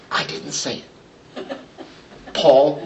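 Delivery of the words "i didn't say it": much softer, nearly whispered; hushed aside. Real, not acted. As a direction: drop to a breathy aside to the congregation. Preacher drops to a hushed aside.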